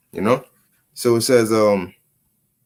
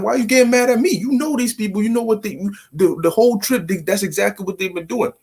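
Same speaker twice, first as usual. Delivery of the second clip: higher-pitched defensive protest; 7 semitones higher than usual. Retold or imitated. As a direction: defend yourself, voice pushed up high. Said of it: "their voice goes higher, your voice also goes higher".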